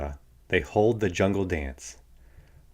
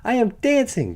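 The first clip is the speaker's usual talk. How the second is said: excited cry; 13 semitones higher than usual. A narrator's reading. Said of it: "Gerald cried".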